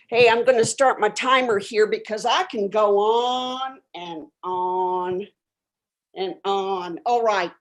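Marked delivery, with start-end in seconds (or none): 3.0-7.0 each ON stretched long, dropping low; drawn-out ON. Pretend. Host joking about rambling, ON held long and low. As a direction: drag out every ON as if endless. Acting out going on endlessly.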